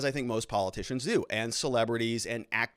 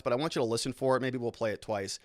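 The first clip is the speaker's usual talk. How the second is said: ordinary delivery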